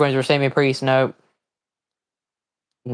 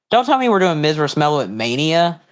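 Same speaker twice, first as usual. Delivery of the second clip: normal talking voice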